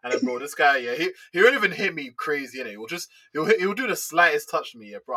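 usual speaking voice

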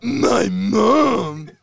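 high, slow, loud sing-song; high, loud voice breaking into a whimper; mimicking the Pops voice. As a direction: echo the silly high voice, cracking up. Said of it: "You know who else talks like that?".

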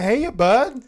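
bright, loud mock-friendly hey; playful reply to a creepy caller; cheery greeting. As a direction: answer like greeting an old buddy. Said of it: "I'm like".

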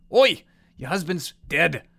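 slow, high, loud Australian bloke; Australian accent. Acted. Safari man tells the widow, slow Australian boom.

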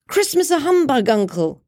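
high, surprised nephew. A narrator's reading. Nephew answers the grumpy uncle, high and amused. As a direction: lift up in amused disbelief. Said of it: "said Scrooge's nephew".

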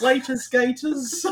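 put-on high, slow parody of an English accent; mock English accent. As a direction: echo it in a silly English accent. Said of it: "people in England say".

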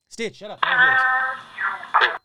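yelling high and loud over the noise; loud yell at the dog. Real, not acted. A host yelling at her noisy dog Stitch, loud shout. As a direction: shout the pet down so you can hear. Stitch, shut up. I wanna hear this.

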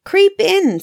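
very high, coaxing witch voice; a witch tricking Gretel toward the oven. A narrator's reading. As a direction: lure the girl into the oven. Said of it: "said the witch".

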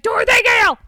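loud, high witch's yell of a name; furious shout. Retold or imitated. Mombi flinging open the door and yelling at Dorothy, loud. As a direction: bellow her name as you burst in.

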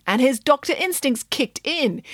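ordinary delivery

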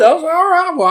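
very high squeaky disbelief. Retold or imitated. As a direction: squeak it out in shock.

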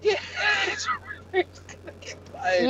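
high, laughing disbelief. Real, not acted. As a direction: laugh through the line. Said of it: "laughing repeat".

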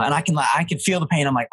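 normal talking voice

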